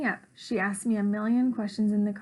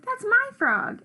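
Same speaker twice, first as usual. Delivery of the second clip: much higher, louder, slower insisting; escalating squabble; 12 semitones higher, 5 dB louder, slower than usual. A narrator's reading. Gobblecracker fights back over the frog, insisting harder. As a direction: top the other claim, pitched right up.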